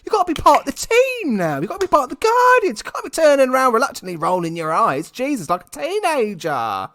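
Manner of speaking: high, loud ranting at the screen, rising as it goes; exasperated rant. Retold or imitated. Ranting at Gamora for rolling her eyes, exasperated. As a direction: scold her like an annoyed fan. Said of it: "I'm like".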